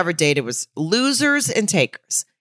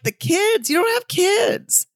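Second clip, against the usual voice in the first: much higher, slower, disbelieving squeak; incredulous mock reply; 14 semitones higher, slower than usual. Podcaster mocking a cast member's claim, incredulous high squeak. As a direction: act stunned that she brought up kids.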